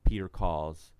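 usual speaking voice

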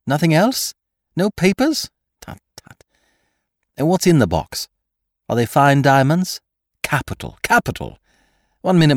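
brisk thief checking on his accomplice; Lupin on the telephone. A narrator's reading. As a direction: fire eager questions down the line.

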